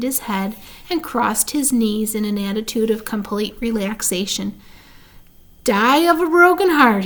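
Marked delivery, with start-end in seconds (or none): 5.6-7.0 high, loud sneering echo; mock his words back at him; mocking mimicry. A narrator's reading. Templeton mimicking Wilbur's broken heart, mocking. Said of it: "he mimicked".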